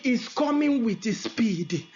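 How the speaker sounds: ordinary talk, nothing marked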